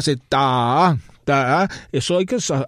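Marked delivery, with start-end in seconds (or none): 0.2-1.7 long, leaned-on "ta"; accented syncopation. Shown as a demonstration. Pianist showing Bach's signature syncopation, "ta" held and accented. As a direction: stretch each "ta" over the beat. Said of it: "acentuarlo en Bach muchísimo".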